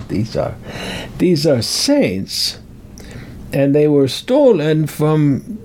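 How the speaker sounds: mock Italian priest accent, high protesting start; terrible Hollywood accent. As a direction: play the priest in a hammy movie accent. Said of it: "that's a terrible accent".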